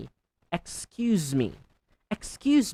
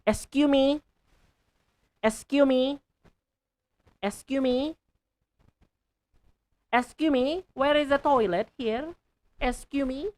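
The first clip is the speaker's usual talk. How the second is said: high squeaky voice; 11 semitones higher, 3 dB louder than usual. Shown as a demonstration. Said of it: "clearly utter every syllable".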